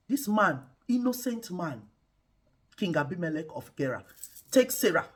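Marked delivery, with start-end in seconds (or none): none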